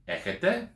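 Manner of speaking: "Έχετε" pitched high as a question; questioning intonation. Shown as a demonstration. Teacher demonstrating a Greek question, questioning intonation. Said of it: "put a bit of intonation on".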